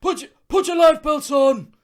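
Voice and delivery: very high, loud emergency order; hammed-up yell across the deck; crewman's shout. Acting a crewman's call.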